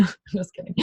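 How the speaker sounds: laughing through the words; light real laugh. Real, not acted. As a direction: giggle it out.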